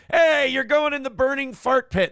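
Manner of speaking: high, loud game show host bark; mock game show shout. Host shouting at Satan about the fart pit, loud and hammed-up.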